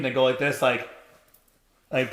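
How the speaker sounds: ordinary talk, nothing marked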